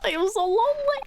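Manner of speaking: high, hammed-up wail in a foreign accent; drifting Slavic accent. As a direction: bemoan it in a bad French-turned-Slavic accent. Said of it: "He's Slavic when he yells".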